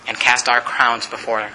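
low, reverent prayer voice; closing prayer. Real, not acted. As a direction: lead the congregation gently to amen.

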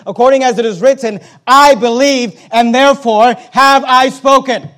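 loud, punched scripture phrases; emphatic preaching. Acted. Preacher quoting what is written, emphatic full-force preaching. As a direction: drive each phrase home loudly.

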